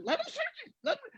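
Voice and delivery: high, hushed frantic plea; a strained high plea under the breath; panicked partner. Retold or imitated. Partner urging again, panicked.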